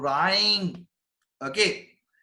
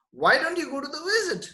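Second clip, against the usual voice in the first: owl's question, pitched up high; the owl's advice, raised for emphasis; higher repeat; 10 semitones higher than usual. Shown as a demonstration. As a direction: lift the whole question much higher.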